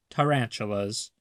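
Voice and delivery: usual speaking voice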